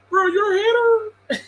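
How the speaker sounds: high, slow, fake-weepy accusation; hammed-up sobbing superfan; mock crying fan. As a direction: wail it like a wounded LeBron fan.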